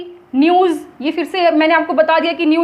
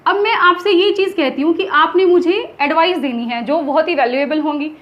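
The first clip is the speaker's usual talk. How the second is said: ordinary talk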